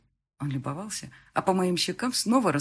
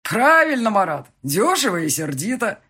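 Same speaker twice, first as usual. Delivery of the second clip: loud, raucous approval, pitched up; guffawing men's cheer; 5 semitones higher, 8 dB louder than usual. A narrator's reading. Wedding guests egging on the groom, boisterous. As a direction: heckle heartily from the crowd. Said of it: "гоготали мужики".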